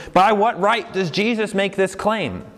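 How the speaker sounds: high, insistent questioning; imagined challenge. Acted. Listener challenges Jesus's claim, high insistent challenge. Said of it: "So you would say to him".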